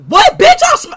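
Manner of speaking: high furious yell; angry shout. Real, not acted. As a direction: scream it back, outraged. Speed hit with slur.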